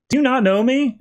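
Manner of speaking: retold puzzled challenge, voice jumping up; incredulous question. Catwoman asks an amnesiac Superman whether he knows her, incredulous. Acted disbelief at not being known.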